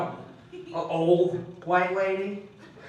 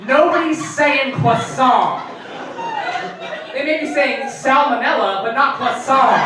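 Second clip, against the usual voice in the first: loud, high mock indignation; exasperated, incredulous retort; indignant comeback; 5 semitones higher, 4 dB louder than usual. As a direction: push back loudly at the food snob. Indignant comeback to pretentious friend.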